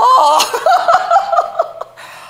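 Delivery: shrieking burst of laughter; high cackling laugh. Real, not acted. Preacher cracking up telling the church her story.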